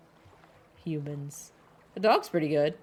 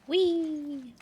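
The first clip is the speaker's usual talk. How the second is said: gleeful whee; 12 semitones higher, 3 dB louder than usual. Real, not acted. Playful cry while swimming.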